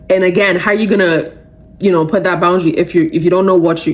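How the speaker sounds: ordinary talk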